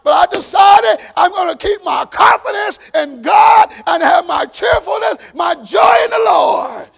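loud, drawn-out declarations, hitting each YES; full-force preaching. Real, not acted. Preacher declaring confidence in God through trials, full-force preaching. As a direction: build a shouted sermon run and hold the last words.

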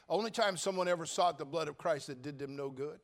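ordinary delivery

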